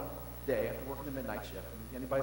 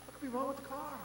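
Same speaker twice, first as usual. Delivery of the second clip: surprised reply; 13 semitones higher, 5 dB quieter than usual. Retold or imitated. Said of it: "I said".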